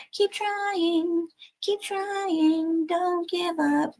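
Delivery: put-on high, soft kids' TV sing-song; Yo Gabba Gabba voice. Tarot reader channels a kids' show to encourage, high and soft. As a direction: chirp it like a cheery kids' show puppet. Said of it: "Yo Gabba Gabba just popped in my head".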